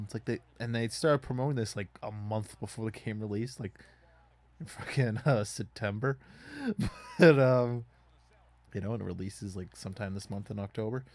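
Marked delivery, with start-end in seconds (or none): none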